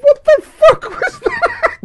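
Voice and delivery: high, loud squeal through laughter; helpless laughter. Real, not acted. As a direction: crack up in disbelief, words squeaking out. Cracking up at the impression.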